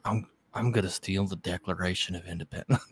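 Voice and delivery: a hint of Nicolas Cage, low and muted; half-hearted movie star voice; Nicolas Cage impression. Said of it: "That sounded a little better than before".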